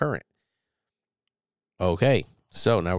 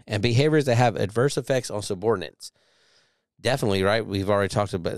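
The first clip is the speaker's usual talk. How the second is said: ordinary talk, nothing marked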